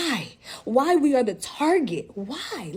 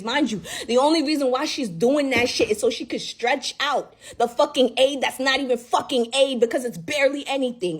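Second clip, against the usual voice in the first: ordinary talk, nothing marked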